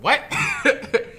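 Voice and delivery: loud, high shocked yelp; incredulous. Retold or imitated. Comic reacting to a baffling compliment, loud incredulous yelp. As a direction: blurt it in disbelief.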